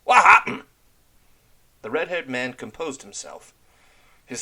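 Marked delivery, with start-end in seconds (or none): 0.0-0.6 much higher, very loud demand; demanding shout. Acted. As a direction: bark the question at him. Red Ram rounds on Tan Lo.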